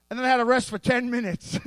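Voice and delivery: normal talking voice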